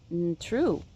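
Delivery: normal talking voice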